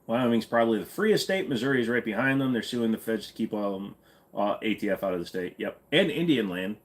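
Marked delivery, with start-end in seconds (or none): none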